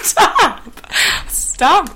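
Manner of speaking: high, loud laughing stop; laughing protest. Real, not acted. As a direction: cry out in mock outrage. Protesting cancer as funny.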